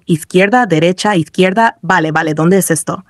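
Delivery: ordinary delivery, nothing marked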